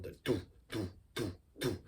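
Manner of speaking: very soft, low doo doo kick pattern; soft kick drum beat. Shown as a demonstration. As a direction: tap out a quiet doo kick rhythm. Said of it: "the kick drum".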